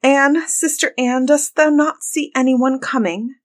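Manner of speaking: high, anxious appeal; crying out. A narrator's reading. Desperate wife asking her sister for news, crying out. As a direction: plead upward in a high voice. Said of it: "and then she cried".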